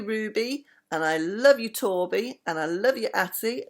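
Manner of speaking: plain reading voice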